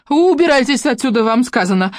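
high, loud shriek. A narrator's reading. Lyubka shrieks at the visitors, high and loud. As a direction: scream them out. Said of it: "Взвизгнула Любка".

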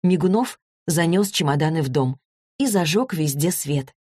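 ordinary delivery, nothing marked

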